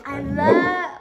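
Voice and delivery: high, loud pet-talk prompt; excited coaxing to a pet; dog trick cue. Real, not acted. Prompting dog to repeat words.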